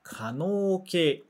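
可能形 read slowly as ka-nou-kei, each syllable distinct; teacher's slow demo of 可能形; slow clear model reading. Shown as a demonstration. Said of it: "o tema da gramática de hoje é esse daqui".

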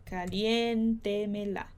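caliéntemela said slowly, each syllable clear; caliéntemela, slow model pronunciation; careful model word. Shown as a demonstration. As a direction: spell out caliéntemela slowly with the stress on TE. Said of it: "faltou um N nesse caliéntemela".

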